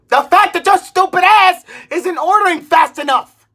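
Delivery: usual speaking voice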